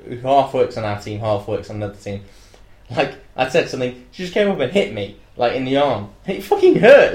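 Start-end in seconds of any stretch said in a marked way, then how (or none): 6.3-7.1 high, loud aggrieved outburst; pained, raised-voice protest; emphatic complaint. Real, not acted. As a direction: exclaim it, still aggrieved. Recounting being hit, stressing the pain.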